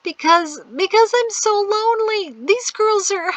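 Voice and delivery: put-on high, tearful girl. A narrator's reading. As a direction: near tears, pleading. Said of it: "Priscille began to cry".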